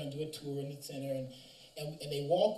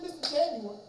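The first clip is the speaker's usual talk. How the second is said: higher, choked-up call to the teacher; tearful address; 9 semitones higher than usual. Retold or imitated. Student calling his teacher through tears, higher choked voice. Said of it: "I can still hear him say it".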